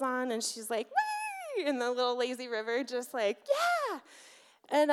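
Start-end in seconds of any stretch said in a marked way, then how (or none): 0.9-1.7 very high, drawn-out squeal of joy; gleeful squeal. Retold or imitated. Child gleeful in the pool. 3.5-4.0 put-on high-pitched childlike cheer; drawn-out, very high yeah; gleeful cheer.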